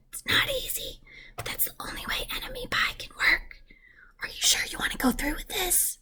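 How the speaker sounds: a soft, secret whisper; dad whispering. Acted. Dad telling his son the secret plan, whispered. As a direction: hush it like a conspiracy. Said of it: "He talked quietly".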